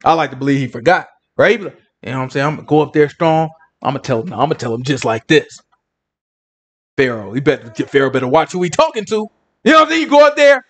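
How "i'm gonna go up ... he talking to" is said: low, self-assured bravado; mock tough talk, pitched well down; confident Moses. Moses rehearsing for Pharaoh, sitting strong and confident. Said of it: "he probably sitting strong".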